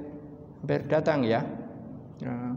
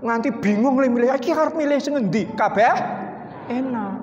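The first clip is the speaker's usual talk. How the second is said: flustered diner; 8 semitones higher, faster than usual. Retold or imitated. Acting a confused diner.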